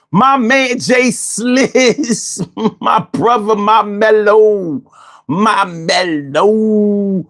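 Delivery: slow, high, stretched shout-out; playful drawn-out greeting. Real, not acted. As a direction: streamer warmly shouting out a friend.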